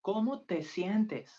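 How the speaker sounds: ¿Cómo te sientes? pitched high, stress on cómo. Shown as a demonstration. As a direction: lift ¿Cómo te sientes? brightly.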